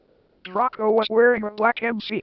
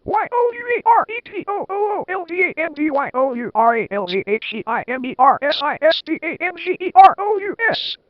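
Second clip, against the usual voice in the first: high, fast, louder spelled-out ranting; hammed-up yell; 8 semitones higher, 4 dB louder than usual. A narrator's reading. Minister telling off the old headmaster, high fast mock yell. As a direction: rattle off the letters like a furious minister. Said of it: "yelled Cornelia Fudge".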